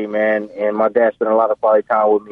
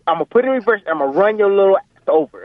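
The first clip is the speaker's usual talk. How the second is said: raised-pitch imitation of dad's threat, speeding up; threatening dad; 9 semitones higher, faster than usual. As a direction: rush out the warning as an intense father. Retold dad threatening his son.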